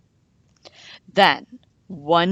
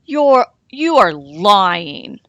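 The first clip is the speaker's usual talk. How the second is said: 1 worried wolf; 2 semitones higher, 8 dB louder, slower than usual. A narrator's reading. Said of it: "But he looked worried".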